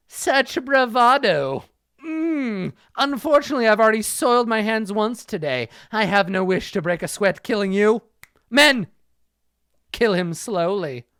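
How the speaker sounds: high, sneering villain who barks the order at "Men"; high mocking villain. Ninja to his men, ordering Jackson killed: high, sneering. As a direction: sneer at the hero, then command the henchmen.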